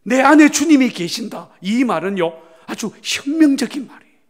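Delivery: preaching climax. Real, not acted. Pastor proclaims to the congregation, climactic preaching. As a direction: proclaim it as revolutionary news.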